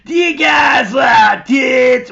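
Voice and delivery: a loud, slow mock proposition; skanky woman's voice. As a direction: ham up the crude toothless woman. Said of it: "That's how she sounded".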